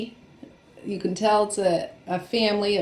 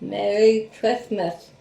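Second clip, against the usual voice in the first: slow, lisping with tongue out; tongue sticking out; 5 dB louder, slower than usual. Shown as a demonstration. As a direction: say it with your tongue stuck out. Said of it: "Stick your tongue out and try to say it".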